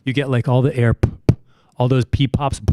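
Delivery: breathy popping mic voice; too close to mic. Shown as a demonstration. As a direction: lean in and pop your p's into the mic. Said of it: "when people talk like this".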